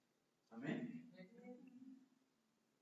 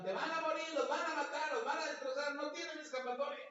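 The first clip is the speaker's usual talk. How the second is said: shouted threats; 7 semitones higher than usual. Retold or imitated. Assyrians shouting threats in Hebrew to the Hebrews, high mock yell. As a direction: yell it to frighten the people. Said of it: "y le gritaban".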